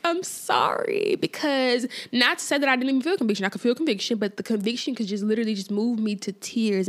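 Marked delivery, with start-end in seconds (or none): 0.0-1.2 high, slow whispered "I'm sorry"; whispered weepy apology, slow and high; tearful sorry. Retold or imitated. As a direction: breathe the apology out as if close to tears. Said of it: "And I'm like".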